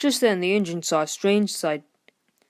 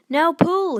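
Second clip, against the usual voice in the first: much higher, louder command; calling out; 11 semitones higher, 7 dB louder than usual. A narrator's reading. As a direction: shout the order. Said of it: "his driver called".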